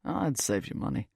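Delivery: put-on low British-accented stranger. A narrator's reading. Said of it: "He has this British accent".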